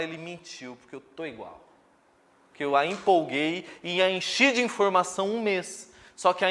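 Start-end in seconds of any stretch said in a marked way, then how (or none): 0.0-1.6 much softer, a letdown member grumbling; disappointed mutter. Retold or imitated. Disappointed churchgoer complaining softly months later. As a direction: mumble it like someone who feels cheated. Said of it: "ela vai falar".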